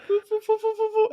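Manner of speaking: high, airy foo foo foo; doctor blowing on the stretched skin, high breathy foo; doctor's blowing noise. Retold or imitated. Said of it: "and she goes".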